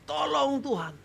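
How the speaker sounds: high-pitched plea without full volume; restrained cry for help. Shown as a demonstration. Preacher repeats the plea for congregation, high restrained cry. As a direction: echo the cry, held back. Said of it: "yang perlu Anda lakukan yaitu teriak".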